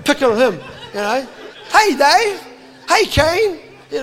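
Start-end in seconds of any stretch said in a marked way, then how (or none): 1.7-3.6 high, loud teasing call; teasing shout. Retold or imitated. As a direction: shout it out to tease your mate. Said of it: "'cause they point at each other".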